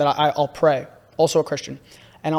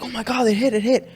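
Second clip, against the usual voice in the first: excited cheer; 7 semitones higher than usual. Retold or imitated. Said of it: "Everyone's like".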